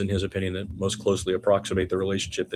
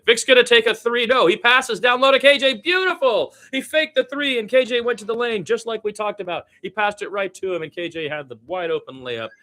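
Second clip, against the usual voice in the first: much higher and louder, a burst of excitement at the play; excited call; 6 dB louder than usual. Real, not acted. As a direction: shout it out as the pass finds him. Big play in the game.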